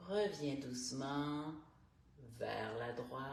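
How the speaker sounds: calm, unhurried instructor guiding each breath; guided stretching voice. Acted. Morning stretch coach guiding listeners through neck and shoulders, calm. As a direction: talk the class softly through every stretch.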